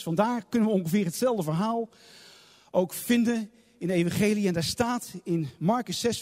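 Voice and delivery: ordinary talk, nothing marked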